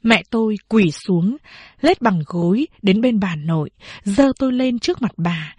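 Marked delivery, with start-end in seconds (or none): none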